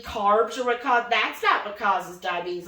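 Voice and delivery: normal talking voice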